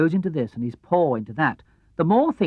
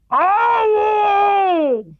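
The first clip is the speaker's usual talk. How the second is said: high loud cry of pain; pained yelp; 8 dB louder than usual. Acted. Tigger crying out at the prickly thistle, a loud yelp.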